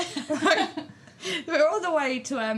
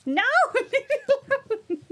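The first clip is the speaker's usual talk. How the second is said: laughing protest; 6 dB louder, faster than usual. Real, not acted. Baby grabbing microphone, mum laughing.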